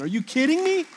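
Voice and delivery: high-pitched disbelief; incredulous. Real, not acted. Preacher amazed by the stolen backpack story, incredulous. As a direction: marvel in a rising, disbelieving question. Amazed that a theft redirected life.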